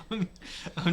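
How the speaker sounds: ordinary talk, nothing marked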